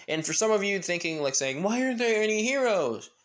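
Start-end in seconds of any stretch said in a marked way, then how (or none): 1.6-3.1 high-pitched mock question; exaggerated, higher-voiced wondering; puzzled viewer. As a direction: imitate a baffled audience, pitched way up.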